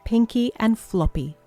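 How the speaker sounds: plain reading voice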